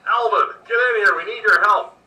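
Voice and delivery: loud, high, urgent call for help; yelling out. Acted. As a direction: yell for backup across the fray. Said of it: "he yells out".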